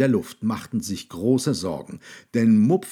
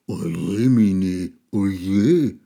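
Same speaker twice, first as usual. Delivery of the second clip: louder, lament in a monster voice; monster dismay; 5 dB louder, much slower than usual. A narrator's reading. Monster sees the mess and laments, louder. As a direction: groan it in dismay.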